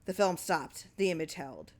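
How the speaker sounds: ordinary delivery, nothing marked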